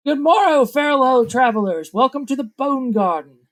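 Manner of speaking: high, loud showman's greeting; theatrical merchant hailing from his cart; peddler's hail. Acted. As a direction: call out a grand welcome to travelers. Peddler waves and hails travelers.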